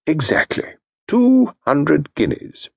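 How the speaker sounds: slow, every word set down firmly; calm, deliberate confirmation; slow firm statement. Acted. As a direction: repeat the price unmoved. Firm reply.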